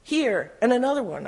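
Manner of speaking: high-pitched, understated wail; mild wail. A narrator's reading. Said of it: "I wailed".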